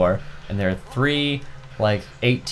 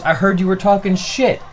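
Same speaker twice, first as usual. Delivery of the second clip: lightly voiced confrontational kid, higher; hint of a mock challenge; imitated accuser; 8 semitones higher than usual. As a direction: put on a higher-pitched accusing voice. Voicing the accusing stranger.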